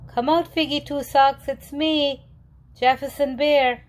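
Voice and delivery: high call through the woods. A narrator's reading. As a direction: call out coaxingly to a hidden friend. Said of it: "He walked through the woods calling".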